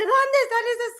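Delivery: high, demanding grandma voice; imitated scolding grandma. Grandma hunting the hiding cousins, high mock scolding. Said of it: "porque también era muy gr-, muy grosera".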